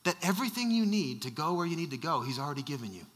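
quiet, loosely imitated Nigerian voice; gentle attempt at a Nigerian accent, dropping low; soft-spoken Nigerian accent. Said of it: "very soft-spoken Nigerian man".

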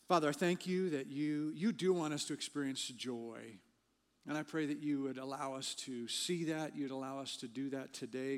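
quiet sincere praying that softens toward the close; prayer. Real, not acted. Pastor leads congregation in prayer before sermon, quiet earnest prayer.

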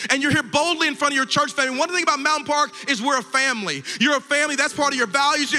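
high, rousing preacher's voice; impassioned preaching. Real, not acted. Pastor addressing those who came forward boldly, impassioned preaching. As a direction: proclaim it to the whole church.

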